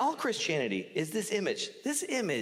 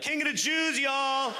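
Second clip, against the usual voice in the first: cheering crowd; 8 semitones higher than usual. Acted. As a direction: shout it like fans hailing a king. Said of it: "his friends were like".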